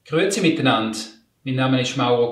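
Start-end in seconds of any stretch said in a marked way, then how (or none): none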